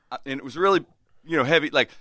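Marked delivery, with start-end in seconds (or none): none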